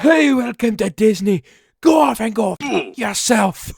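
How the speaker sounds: put-on loud, high Scottish accent yell; over-the-top Scottish accent shouted at passing tourists. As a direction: bellow it in a hammed-up Scottish accent. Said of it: "I yelled it out loud in a Scottish accent".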